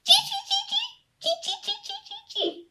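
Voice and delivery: very high squeaky monkey chatter. A narrator's reading. Monkeys answer the peddler in very high chatter. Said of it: "and they said".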